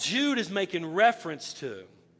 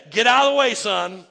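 loud commanding voice of God; God's booming command; 9 dB louder than usual. Retold or imitated. Preacher voicing God to Moses, loud commanding. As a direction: boom it like an impatient God. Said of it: "He said".

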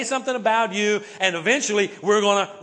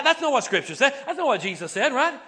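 ordinary talk, nothing marked

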